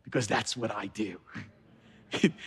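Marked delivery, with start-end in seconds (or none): 0.0-1.2 much softer, almost confided; dropped soft. Shown as a demonstration. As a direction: let "because that's what I do" fall soft and low. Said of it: "here's the good part".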